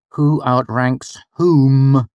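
slow, reluctant self-correction; grudging. Acted. The boy correcting "who" to "whom" for his mother, grudgingly. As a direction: drag out "whom" unwillingly. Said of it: "I said grudgingly".

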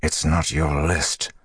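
a restrained growl, drawn out. A narrator's reading. Rand to the madman in his head, growling. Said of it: "Rand growled".

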